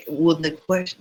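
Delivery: normal talking voice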